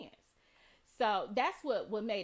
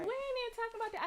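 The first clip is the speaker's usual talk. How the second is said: quick dismissive; 11 semitones higher, much faster than usual. Retold or imitated. Cuts off other mother's rant.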